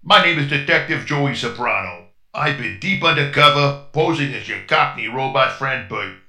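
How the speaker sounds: put-on a light New York detective accent; lightly voiced tough-guy cop; gangster accent. Robot posing as detective to the crew, light New York accent. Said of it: "talking in a strange accent".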